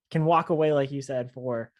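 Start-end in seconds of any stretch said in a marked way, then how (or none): none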